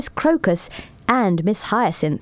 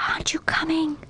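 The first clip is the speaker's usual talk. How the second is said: whispered, higher and soft; soft call; 5 semitones higher, 7 dB quieter, faster than usual. A narrator's reading. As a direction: breathe the question like grass in the wind. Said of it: "called out softly".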